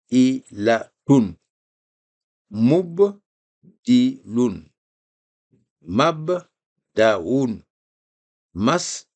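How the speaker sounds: each syllable clipped off with a short pause; syllabes détachées. Shown as a demonstration. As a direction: chop the words into syllables. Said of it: "vous pouvez séparer les syllabes". (syllabes séparées, une à une)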